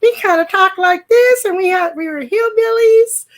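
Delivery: high-pitched, loud hillbilly character; hillbilly girl character voice. Shown as a demonstration. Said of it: "the tone of my voice".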